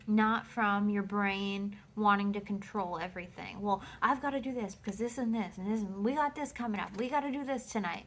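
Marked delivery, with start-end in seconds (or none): 3.6-8.1 fast, breathless list of chores; overwhelmed rattling. Retold or imitated. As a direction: rattle off the to-dos like you're drowning in them. Mimics an overbusy, controlling self.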